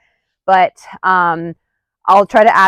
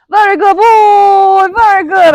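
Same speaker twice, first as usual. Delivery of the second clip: high, loud, slow praise for the dog; 13 semitones higher, 7 dB louder than usual. Real, not acted. Trainer praising her dog as the session ends. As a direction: draw out the praise.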